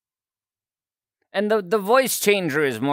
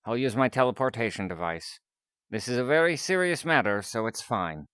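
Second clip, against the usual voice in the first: composed scientist on a call, a touch lower; lightly voiced scientist; 5 semitones lower, 4 dB quieter than usual. Scientist assuring caller help is coming, composed. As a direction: play a scientist calmly answering a worried caller.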